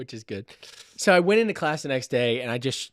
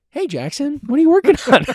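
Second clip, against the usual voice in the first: higher, louder bright TA greeting; imitating the TA; 12 semitones higher, 11 dB louder than usual. TA Sophie greeting the student, higher and louder. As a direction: chirp it like a cheerful teacher dropping by. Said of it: "she was like".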